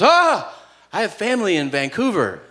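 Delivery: high excited gasp, then eager chatter; overexcited small-talk voice; mock delighted clerk. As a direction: squeal with discovery, imitating a chatty stranger. Counter clerk acted, thrilled at connection.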